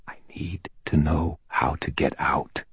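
voiceless, careful whisper; mouthed words. A narrator's reading. Man nodding at Toot, asking the way out, whispered. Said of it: "I nodded at him and mouthed".

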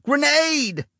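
very high screamed warning; screaming. A narrator's reading. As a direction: yell it as you dive. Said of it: "Paulus saw it and screamed".

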